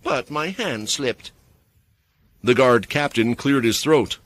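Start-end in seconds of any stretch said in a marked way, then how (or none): 0.0-1.3 sweetly. A narrator's reading. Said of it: "Mia replied sweetly".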